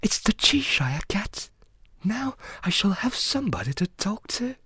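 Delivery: soft, high murmur of recognition; said to herself. A narrator's reading. Alice recognising the Cheshire Cat, softly to herself. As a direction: talk privately in a high, soft voice. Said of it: "she said to herself".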